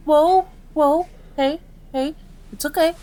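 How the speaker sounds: high, alarmed soothing; startled calming. Acted. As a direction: yelp first, then try to settle a panicking patient. Startled, calming patient throwing things.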